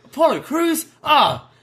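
very high puzzled question, then delighted approval; imitated surprise. R-Truth learning who beat Waller, imitated high surprise. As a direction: play pleasantly surprised, way up high.

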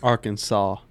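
"Arkansas" as AR-kun-saw; silent final S. Shown as a demonstration. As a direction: finish "Arkansas" on a clean saw, no L or S. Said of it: "The S would be silent".

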